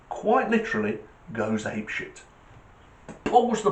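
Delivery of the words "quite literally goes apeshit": ordinary delivery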